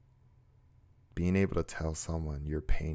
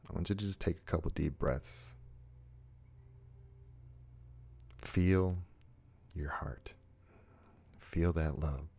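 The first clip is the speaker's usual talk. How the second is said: soft, unhurried guided meditation voice; guided heart moment; 3 dB quieter than usual. Acted. Host guiding viewers to love themselves, soft meditative voice. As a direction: gently talk the listener into a calm moment.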